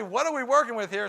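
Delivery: eager catchphrase. Shown as a demonstration. Husband revving up family for a big plan. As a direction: announce a big plan with gusto. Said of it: "And so when I say".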